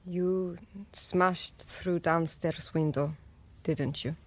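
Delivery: low, slow and hushed; grave realisation. Acted. Annie realising what Sam did, grave and quiet. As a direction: piece it together softly and heavily.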